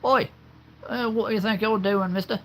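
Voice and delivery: very high British character voice; high, indignant British telling-off; put-on British accent. Shown as a demonstration. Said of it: "I can do a little bit of accent".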